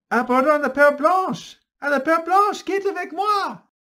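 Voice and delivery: loud, high rallying shout; rallying cry. Acted. A man rallying men to the ship, loud. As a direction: rouse the men to board. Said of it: "Un homme s'exclame".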